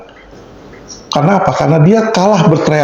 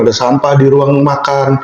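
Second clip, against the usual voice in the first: ordinary delivery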